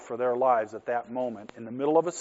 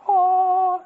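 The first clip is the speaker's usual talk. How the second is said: a much higher, louder hammed-up angelic "Aw"; mock angel singing; 11 dB louder than usual. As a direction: sing it out like a cartoon angel choir. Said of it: "that's an angel singing".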